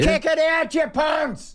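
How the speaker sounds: imitating a fan screaming at the players; mock angry fan yell. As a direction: bellow at the players like a furious fan. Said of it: "the guy was saying".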